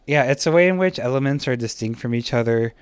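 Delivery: quick, hurried explanation; speeding up. Real, not acted. Teacher speeding up a lecture to catch up, quick pace. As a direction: rush through the definition to save time. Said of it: "I need to go a lot faster".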